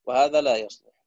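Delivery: ordinary talk, nothing marked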